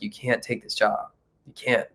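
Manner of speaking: softly voiced inner warning; quiet inner voice. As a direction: act out a hushed plea to yourself.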